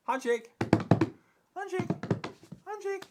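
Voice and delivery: high, loud repeated command; calling the dog. Real, not acted. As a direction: call it out to the dog, rising each time. Commanding dog to give paw.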